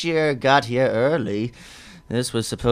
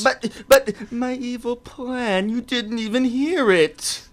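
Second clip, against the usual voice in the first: whining villain; 10 semitones higher, slower than usual. Acted.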